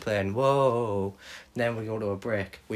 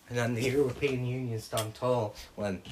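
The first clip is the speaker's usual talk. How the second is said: ordinary talk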